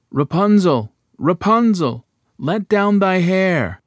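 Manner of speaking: loud, slow call up to the tower; prince calling out. A narrator's reading. King's son copies the witch's call, loud but in his own voice. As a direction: call up to her, loud and drawn out. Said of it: "the king's son came and cried".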